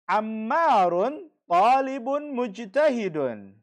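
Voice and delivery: slow, loud and deliberate; model sentence. Shown as a demonstration. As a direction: spell it out slowly for the class to repeat. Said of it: "teacher reads example sentence aloud". (pelan, keras, jelas seperti guru)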